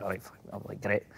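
very low, barely audible reply; muted acceptance. Retold or imitated. Player retelling his reply about training, muted. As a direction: drop to a resigned-sounding undertone.

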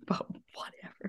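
laughing whispered echo; mock whisper. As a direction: breathe it back as a playful whisper. Echoing friend's words with a laugh.

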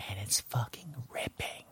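hushed, breathy whisper of awe; whispered rave. Retold or imitated. Telling a friend the show rips, whispered. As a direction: whisper it like a secret thrill.

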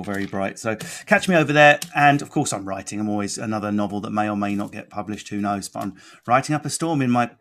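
normal talking voice

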